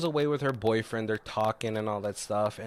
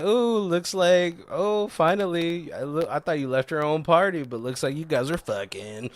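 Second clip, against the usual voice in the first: teasing imitation; 5 semitones higher than usual. Friend ribbing a couple back from sex, teasing imitation.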